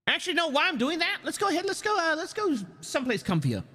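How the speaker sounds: high, quick and bubbly; high streamer voice. Acted. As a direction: chirp it out high and fast. High fast streamer chatter.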